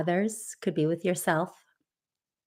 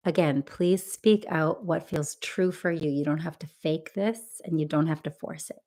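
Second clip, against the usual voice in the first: normal talking voice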